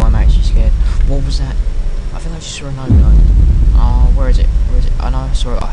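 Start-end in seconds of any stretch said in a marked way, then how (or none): none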